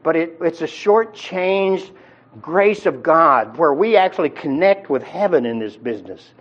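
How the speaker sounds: usual speaking voice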